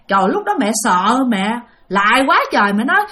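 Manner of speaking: normal talking voice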